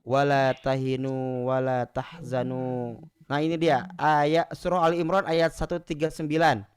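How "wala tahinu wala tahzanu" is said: slow, lower melodic recitation of the verse; slow Quranic chant. Acted. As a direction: recite it like tilawah.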